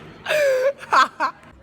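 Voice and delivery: high, loud cackling shriek of laughter. Real, not acted. Called a fuckwit by her friend, loud high shrieking laugh. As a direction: burst into a squealing laugh.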